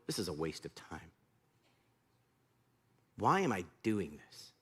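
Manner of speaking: low, quiet, discouraged muttering; weary grumbling. Acted. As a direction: grumble to yourself, doubting the whole task. Imagining Naaman tiring of dipping.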